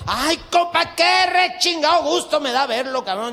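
loud, high, back-slapping welcome; hearty loud greeting. Acted. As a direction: greet the compadre at full volume. Hearty greeting between compadres. (saludo efusivo a gritos, agudo)

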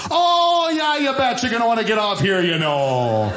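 loud, high, folksy Minnesota accent, hammed up. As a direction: belt it out like a cheery Minnesota local. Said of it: "she goes".

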